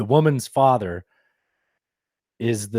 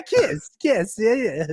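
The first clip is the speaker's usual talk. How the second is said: put-on hammy high French accent, kiss kiss; lightly mock her French accent; 9 semitones higher, much slower than usual. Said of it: "She sounds like Borat".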